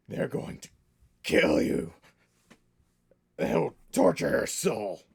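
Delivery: rough, growled threat, swelling loud; gruff Southern orc. Acted. GM as wounded orc warning the party of torture, gruff menace. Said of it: "it's just gonna come out gruff".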